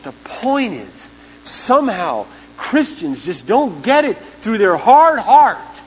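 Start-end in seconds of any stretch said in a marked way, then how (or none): 1.4-5.8 "heart" punched high and loud, slow; slow, rising emphasis on "hard heart"; stress on heart. Real, not acted. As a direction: drive home "heart". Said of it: "not their thick skull".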